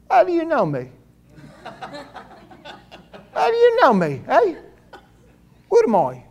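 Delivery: put-on comic high-pitched Brooklyn accent; high, incredulous New Yorker accent. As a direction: squawk it in mock thick Brooklyn. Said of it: "thick Brooklyn accent here".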